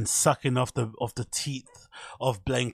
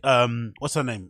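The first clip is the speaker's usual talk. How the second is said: usual speaking voice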